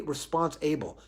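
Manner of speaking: "response-able" said as response plus able; split word. Shown as a demonstration. Teacher reveals able inside responsible, "response-able" as two words. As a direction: give "response-able" a full "able" at the end.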